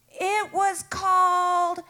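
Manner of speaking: Kama Sutra jumps way up high; innocent reveal. Retold or imitated. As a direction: climb up high and land proudly on Kama Sutra. Sister names the yoga proudly.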